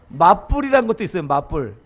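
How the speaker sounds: ordinary talk, nothing marked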